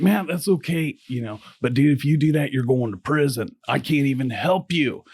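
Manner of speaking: a lawyer annoyed at his client's plan; pissed-off attorney. Retold or imitated. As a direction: push back hard, warning him off. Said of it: "he got pissed".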